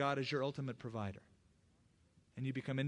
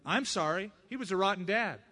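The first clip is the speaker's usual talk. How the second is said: mock protest; 5 semitones higher, 6 dB louder than usual. Arguing back to the Lord.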